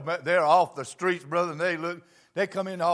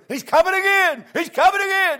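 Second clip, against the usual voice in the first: high, loud excited shout; shouted response; 13 semitones higher, 10 dB louder than usual. Retold or imitated. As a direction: jump up and shout it back like the crowd. Congregation jumping up, shouting.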